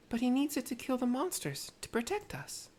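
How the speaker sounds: put-on high, small child's voice; little girl voice. Little girl thanking Tepeke, high child voice. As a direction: sound like a grateful little girl.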